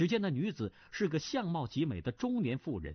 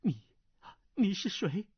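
shaken; 7 semitones higher than usual. Acted. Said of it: "身子摇摇欲倒，问道".

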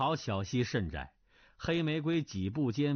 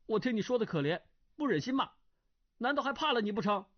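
put-on higher, earnest young scholar's voice; a raised, indignant young gentleman; young man Duan Yu; 8 semitones higher, much faster than usual. A narrator's reading. (升高音调的年轻书生声)

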